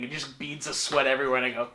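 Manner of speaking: normal talking voice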